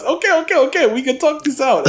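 hammed-up high-pitched surrender; mock panicked bargaining. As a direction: squeal a comic plea for a truce. Repeats the attacker's comic plea.

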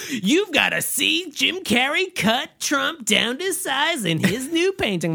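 high, slow hyped headline; clickbait headline voice. Retold or imitated. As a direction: hype it like clickbait. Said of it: "by going".

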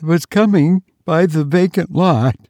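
put-on high, panting girl's account; breathless. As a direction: gasp the story out in broken bits. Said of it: "in a breathless voice".

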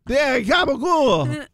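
slow, high, drawn-out New York Italian gabagool; hammed-up Jersey Italian gabagool; mock Italian-American. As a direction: savor the word gabagool like a mob cliché. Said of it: "A gabagool".

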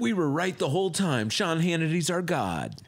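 high-pitched mock triumphant news host; mock gloating. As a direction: crow it as a spoof of cable hosts.

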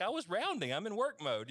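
mock wife excuse. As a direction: play her defending herself with a light mocking lift. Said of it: "she's like".

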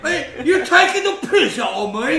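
loud, high laughing British hooligan; mock rowdy British lad; British hooligan accent. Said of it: "talk like rugby hooligans from Britain".

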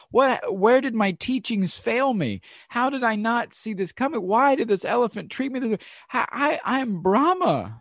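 high, baffled, pleading questions; bewildered disbelief. Retold or imitated. Thrown man asking why his teachings failed, high and bewildered. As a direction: cry out confused from the ditch. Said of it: "he's wondering".

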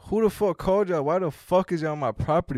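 loud, high-pitched indignant outburst; popping off. Retold or imitated. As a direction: pop off at the cops in outrage. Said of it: "I, I pop off".

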